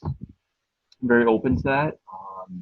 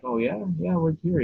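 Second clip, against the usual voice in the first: higher, light mock-surprised reply; mock surprise; 8 semitones higher than usual. Son asking his dad, mildly mock surprised. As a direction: act curious about what he heard. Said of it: "I was like".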